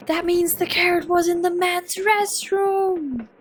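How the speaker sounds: high, loud, slow triumphant announcement; dramatic reveal. Acted. Character announcing where the carrot is, loud triumphant reveal. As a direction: declare it like a detective cracking the case.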